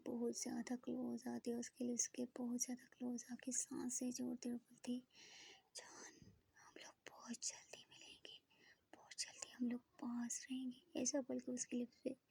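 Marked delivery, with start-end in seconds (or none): 5.8-11.0 very soft breathy whisper, slow; intimate whisper. Acted. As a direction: breathe the words against her lips. Whispers close to her lips.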